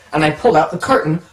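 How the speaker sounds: ordinary talk, nothing marked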